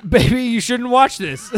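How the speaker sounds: laughing through the words; giggling while talking. Real, not acted. Laughing at own joke.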